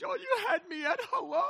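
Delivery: high-pitched hammed-up weeping wail; mock crying. Crying movie heroine answers her man, mock-sobbing. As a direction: wail it tearfully, as a send-up. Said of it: "she's crying".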